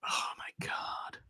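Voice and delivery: breathy whispered disbelief; stunned whisper. Real, not acted. Reacting to texts.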